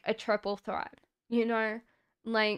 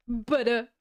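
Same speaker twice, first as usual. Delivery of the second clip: mock British butter said as putta, no r; a light teasing British butter; British accent; 5 dB louder than usual. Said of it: "Why is British, like, always saying butter?".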